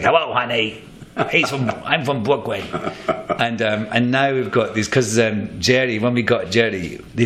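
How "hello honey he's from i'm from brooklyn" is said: put-on a light Brooklyn accent, laughing at the end; lightly voiced Brooklyn guy; playful dog voice with a hint of a Brooklyn accent. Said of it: "And Leon was like".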